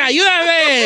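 high, comic plea for help; hammed-up drunken plea; drunk on a swing. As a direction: whine it like a helpless drunk. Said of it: "Era en los columpios un borracho".

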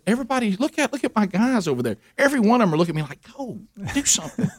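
rally her to see his starving friends; excited protest. Retold or imitated. Husband pleads with his wife about the food, excited and high. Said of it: "And I said".

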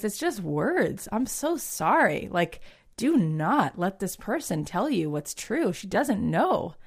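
very slow, emphatic comfort; earnest reassurance. Retold or imitated. Friend consoling her after the doctor's verdict, slow earnest reassurance. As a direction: draw each phrase out to make it sink in.

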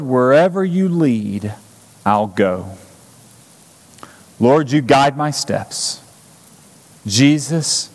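measured prayer with long pauses; leading a prayer. Real, not acted. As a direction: pray phrase by phrase, leaving room to repeat. Leads congregation in prayer.

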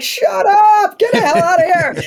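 retold squeal of joy over the Emmy; excited disbelief. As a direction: squeal it like you can't believe the news. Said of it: "I'm like".

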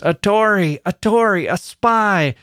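high, hammered-up mob shouts; crowd shout. A narrator's reading. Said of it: "a general shout burst from the bystanders".

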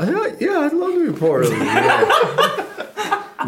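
squeaky high, laughing yeah; choked-up squeak of a yes; voice went up. Retold or imitated. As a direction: voice cracking up high with joy. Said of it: "I went up in my ro".

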